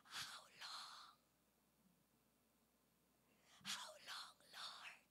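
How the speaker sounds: very soft, breath-only pleading whisper; hushed, voiceless plea of a hurting prophet; pained cry, whispered. Acted. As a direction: whisper a tearful prayer with long pauses. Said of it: "umiiyak at sumisigaw si Habakuk dito".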